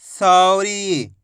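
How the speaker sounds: Sorry as one drawn-out word; syllables joined. Shown as a demonstration. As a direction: blend सौ and री without a pause. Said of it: "तो मिलके बन जाएगा".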